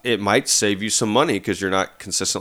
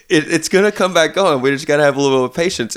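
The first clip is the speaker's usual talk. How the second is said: higher, fast reassuring parent voice; patient parent; 6 semitones higher, 4 dB louder, faster than usual. Retold or imitated. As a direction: soothe a toddler, quick and bright. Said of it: "I'm like".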